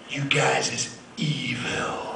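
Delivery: slow whisper; whispered warning. Retold or imitated. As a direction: breathe it slowly like a spooky secret. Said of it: "he goes".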